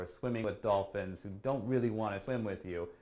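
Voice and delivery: normal talking voice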